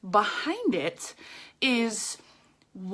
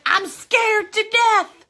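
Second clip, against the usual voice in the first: slow, drawn-out admission, a bit louder and higher; 1 mock confession; 8 semitones higher, 6 dB louder, much slower than usual. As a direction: act out confessing your fear to a live audience. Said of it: "get on Facebook Live and be like".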